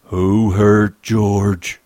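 very slow, loud, heavy question; menacing demand. A narrator's reading. Lennie stands up to Crooks over George, menacing demand. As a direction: draw out each word as a threat. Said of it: "he demanded".

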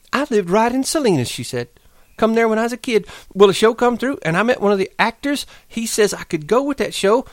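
high, rushing dreamy account; eager outpouring. Acted. Curly's wife pours out her past to Lenny, high and eager. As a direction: pour out her dream before he leaves. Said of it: "her words tumbled out in a passion".

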